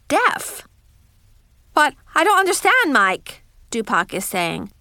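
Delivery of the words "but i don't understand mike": lightly voiced roommate, baffled and quick; higher, louder opening that drops on the name. A narrator's reading. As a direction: protest in puzzled disbelief, starting high.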